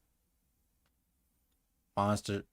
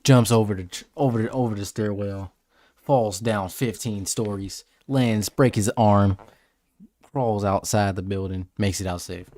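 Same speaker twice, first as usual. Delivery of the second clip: loud, punchy blow-by-blow; hammed-up action narration; 4 semitones lower, 10 dB louder than usual. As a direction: belt out each stunt in short bursts. Hypes up an imagined escape.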